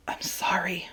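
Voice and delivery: barely voiced whisper; whispered apology. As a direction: breathe the apology into his fur. Boy apologizes to dog, crying.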